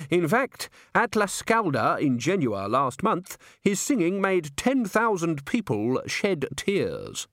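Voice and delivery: slow, high, self-important voice; fussy thin man singing praises; prim manager boasting. A narrator's reading. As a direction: announce it with drawn-out pride.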